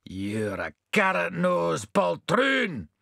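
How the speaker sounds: a touch of loud scorn, drawn out; loudly. A narrator's reading. Pudding insulting the constable, mildly loud. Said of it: "said the pudding loudly".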